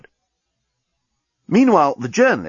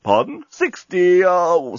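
talking bomb; 7 semitones higher, 3 dB louder, slower than usual. Acted. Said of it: "said the bomb".

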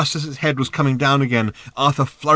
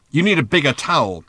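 higher, argumentative comeback; bickering retort; 4 semitones higher, 4 dB louder than usual. A narrator's reading. Ford bickering with Arthur over towels, retorting. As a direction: cap the argument with a pointed jab.

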